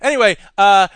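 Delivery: ordinary delivery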